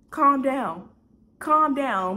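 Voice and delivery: mock-yell it as an urgent order; imitated yelling. Man to the crying caller, imitated yelling. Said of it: "he's yelling, yelling".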